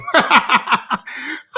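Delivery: high, bubbly burst of laughter; delighted laugh. Real, not acted. Delighted by viewer's comment.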